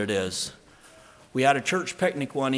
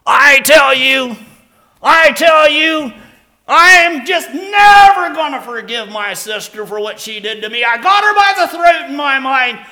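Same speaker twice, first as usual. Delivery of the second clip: furious yelling; 16 dB louder than usual. Retold or imitated. Mick yelling at the pastor about his sister, loud furious shout. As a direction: scream out your bitterness. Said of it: "furious and yelling".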